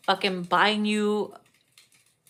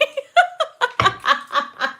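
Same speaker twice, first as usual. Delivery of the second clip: high-pitched laugh; 13 semitones higher than usual. Real, not acted. Cracking up.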